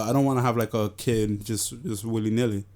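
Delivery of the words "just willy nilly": ordinary talk, nothing marked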